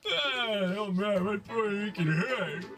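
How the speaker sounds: slow, groaning hangover voice; hungover groan. Acted. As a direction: groan through a pounding head. [name] hungover.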